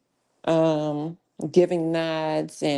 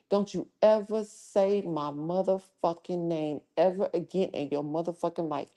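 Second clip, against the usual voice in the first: quiet menace; 6 dB quieter than usual. Real, not acted. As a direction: drop the volume and lean into the threat. Threatening a chatter on stream.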